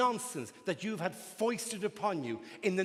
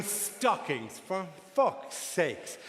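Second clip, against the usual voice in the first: normal talking voice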